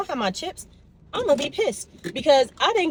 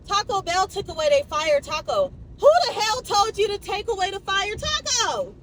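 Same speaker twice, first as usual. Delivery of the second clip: high, heated rant about fast food; indignant rant, pitched way up; 6 semitones higher than usual. Real, not acted. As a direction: vent like the menu wronged you personally. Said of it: "I'm ranting".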